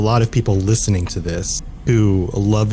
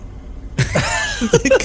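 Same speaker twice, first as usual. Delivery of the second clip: high squealing laugh. Real, not acted. Laughing at elephant joke.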